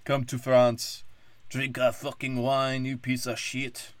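slow, mocking fake French voice. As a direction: drawl it out like a smug Frenchman. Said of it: "They're like".

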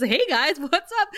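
imitated breezy kid greeting; oblivious chirpy greeting. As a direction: pop in innocently, lifting the pitch at the end. Acting Dawn walking in unaware.

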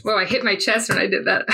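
usual speaking voice

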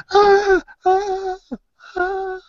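high, loud repeated shriek, tailing off; acted screaming. Game master voices boy staring at dead beast, screaming. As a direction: keep screaming as the panicked boy. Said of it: "now he's screaming".

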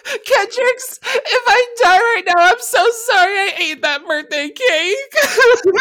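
very high, wailing half-laughing confession; mock deathbed confession. Ranger confesses eating the birthday cake, high laughing wail. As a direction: sob out a silly last confession.

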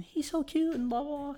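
very high, soft mock-girlish voice; gushing girls. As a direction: mimic smitten girls in a small high voice. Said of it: "They're like".

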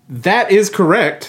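slow game-show host reveal. Acted. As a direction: reveal it like a quiz host milking the moment. Game host reveals the right answer.

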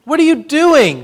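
loud, high bellowing shout. A narrator's reading. Grandpa catching Benny at the ark, bellowing. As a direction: bellow it in shock. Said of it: "Grandpa bellowed".

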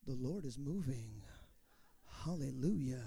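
low, slow, awed church voice; mock pious rapture. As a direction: sigh it out like a stunned believer. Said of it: "I was like".

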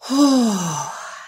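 long audible sigh to let tension go; deep calming breath. Real, not acted. Mom calming herself before facing her kids, long sigh. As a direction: breathe out slowly and fully. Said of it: "I took a deep breath".

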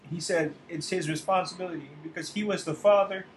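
halting, tear-choked, long pauses; barely holding it together over the father; real choked-up telling of the flood victim; fighting tears. Real, not acted. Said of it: "trying to stifle some tears".

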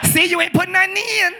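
hammed-up Chicago accent, high and loud. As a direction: shout it in a mock Chicago accent.

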